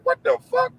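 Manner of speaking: shocked outburst. Retold or imitated. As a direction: catch him cursing and jolt upright. Dad hearing son curse.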